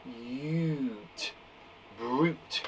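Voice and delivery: softly said oot then Brute; teacher modelling the sounding out of Brute; segmenting sounds. Shown as a demonstration. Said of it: "You'll go".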